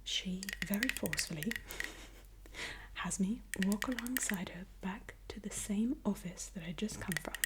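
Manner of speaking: ordinary talk, nothing marked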